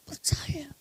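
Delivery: a hushed, breath-only "Percaya"; whispered answer. Acted. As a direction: lean in and breathe the word.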